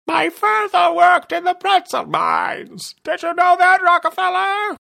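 villain tests a new pitch and volume on the detective; very high loud. Acted. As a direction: shout it in a falsetto so he hears. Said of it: "experimenting with pitches and volumes".

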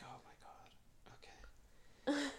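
very slow, voiceless whisper; stretched-out whispered aside to oneself; dismayed whisper. Retold or imitated. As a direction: breathe it out in mock dread, barely audible. Retold dismayed reaction under breath.

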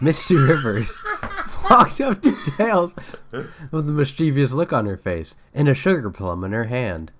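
plain reading voice

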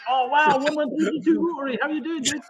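put-on light up as if meeting an old friend; over-the-top happy welcome; mock excited greeting. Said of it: "just like".

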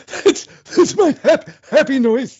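high squeaky imitation of the happy noise; mock happy squeal. Friend joking along, squeaking the happy noise line. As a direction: squeal it like a delighted character.

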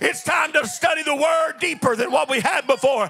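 rising to a full preaching shout. Acted.

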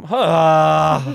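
long, loud exasperated yell; frustrated scream. Retold or imitated. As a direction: let out a drawn-out scream of defeat. Scream as flies land. (långt, frustrerat skrik)

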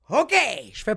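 normal talking voice

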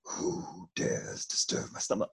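deep rumbling cave voice, unvoiced; grinding stone statue. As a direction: boom it like a giant stone cave. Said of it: "he based the voice of the Cave of Wonders ... on Sean Connery".

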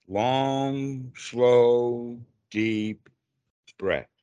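long, slow words with pauses between; drawn out slowly. Shown as a demonstration. Demonstrates long slow breath pace.

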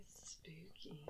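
soft whispered spooky; Moira's spooky. Retold or imitated. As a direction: hush it out dramatically. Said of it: "clip of her saying".

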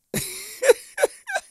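a laugh that climbs high. Real, not acted. Podcaster laughing at the slap joke, high giggle.

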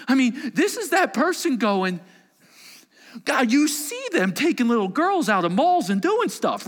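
high, loud pleading outcry; anguished cry to God. Acted. A psalmist crying out to God, loud and high. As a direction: demand answers from God. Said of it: "This is that cry of".

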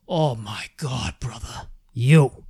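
put-on slow, drawn-out Boston accent; coworker's Boston accent. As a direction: stretch it out like the Boston guy in the cooler. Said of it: "like, Boston accent".

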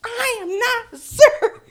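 high, loud, laughing protest; indignant correction. Retold or imitated. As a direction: yell it back, offended and amused. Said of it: "I was like".

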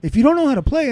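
high loud mock yell; imitating the dealer yelling at a newcomer; retold dealer yelling. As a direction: bark it down at the new player. Dealer's yelled quote.

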